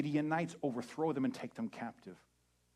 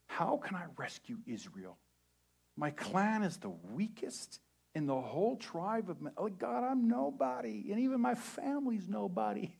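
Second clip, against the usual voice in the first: whiny Gideon; 9 semitones higher than usual. Retold or imitated. Gideon excusing himself to God, whiny. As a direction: complain you're nobody. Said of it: "Gideon replied".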